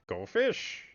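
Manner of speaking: a high, mocking sing-song go fish; brutish fisherman taunt. Gamer voicing the fisherman mid-fight, high mocking taunt. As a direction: tease like the big fisherman. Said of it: "something brutish".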